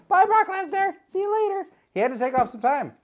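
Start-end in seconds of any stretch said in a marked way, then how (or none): 0.1-1.7 high-pitched send-off; mock farewell. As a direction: call goodbye in a silly high voice. Waves the departing figure off.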